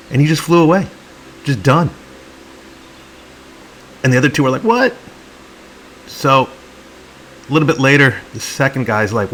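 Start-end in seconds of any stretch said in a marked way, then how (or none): 4.6-4.9 sudden very high, loud "What?"; shocked squeak. Acted. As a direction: squeak it out like a stunned baby bird. Birds shocked sibling flew off.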